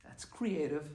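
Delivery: soft, deadpan backhanded praise; a rabbi's quiet ironic verdict; dry creative. Retold or imitated. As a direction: say it as a polite put-down. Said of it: "you would look and they go".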